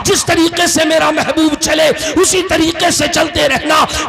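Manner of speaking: high, shouted sermon delivery; impassioned preaching. Acted. Preacher telling the crowd of God's great favour, impassioned preaching. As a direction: preach it in ringing, driving phrases.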